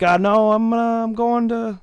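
high, stammering excuse; hammed-up panicky squeak; mock flustered self. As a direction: jump way up and fumble for a lie. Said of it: "I'm like".

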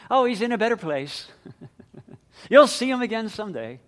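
mock the empty comfort people offered; mocking cliché. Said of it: "worn-out cliches".

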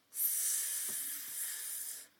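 S- hissed long and unvoiced like a snake; drawn-out s like a snake. Shown as a demonstration. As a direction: hiss the S- like a snake. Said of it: "I sound like a snake".

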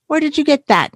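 high-pitched, demanding question. A narrator's reading. Little sister eyeing her brother's paper umbrella, demanding. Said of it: "demanded D W".

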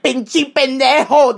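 slow, deliberate Spanish insult. Retold or imitated. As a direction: drag out the insult. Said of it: "he's like".

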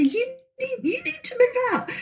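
very high, soft, panicked squeak; terrified squeak. Retold or imitated. Frightened friend urging May to move out, squeaking in terror.

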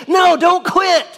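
high, loud exclamation; urgent plea. Acted. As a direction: cry out urgently to stop someone giving up. Urgent plea answering a discouraged quote.

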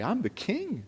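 softly, almost to himself; a hushed royal boast; quiet king's words. Acted. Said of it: "David would say".

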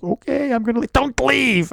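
high, flustered imitation of himself; flustered husband. Husband retelling his own panic in the delivery room, high flustered voice. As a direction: play the helpless husband, voice jumping up. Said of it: "And I was like".

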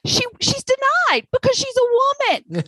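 high, incredulous outrage; indignant. Real, not acted. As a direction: spit out the injustice. Indignant emphasis, high pitch.

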